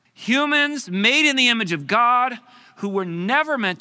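loud, high, impassioned outcry; indignant God. Acted. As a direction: preach God's outrage at full voice. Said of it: "He says".

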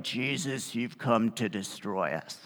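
a demon hissing at the one it fears; creepy demon voice. Acted. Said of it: "in some kind of creepy voice".

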